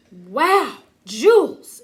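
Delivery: thrilled princess spotting treasure; over-the-top storybook glee; exclaiming. Acted. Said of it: "exclaimed the fair Lucretia".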